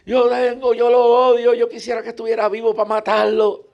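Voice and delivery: put-on old woman on the boat pouring out hurt, high and loud; old woman crying out. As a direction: howl out her pain as the old lady. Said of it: "y dice".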